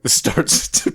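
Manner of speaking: ordinary talk, nothing marked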